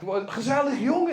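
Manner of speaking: exaggerated Dutch slang voice; trailer-park Dutch. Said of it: "a bunch of people from, like, from Holland".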